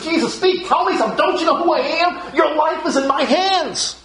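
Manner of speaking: a ruler demanding an answer; demanding Pilate. Retold or imitated. Pilate pressing silent Jesus with high, commanding insistence. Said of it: "It's as if Pilate is saying to Jesus".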